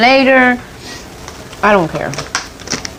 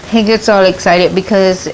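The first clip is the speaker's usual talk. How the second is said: normal talking voice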